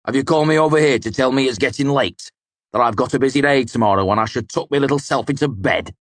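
put-on a rough drunk needling the boy; drunk mercenary voice. Dedan's drunken gruff voice.

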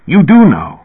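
put-on narrated old man's heated insistence; furious. A narrator's reading. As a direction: throw it out as an angry accusation. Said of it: "Elias said furiously".

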